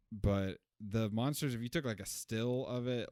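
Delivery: ordinary delivery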